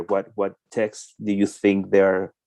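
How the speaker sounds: ordinary delivery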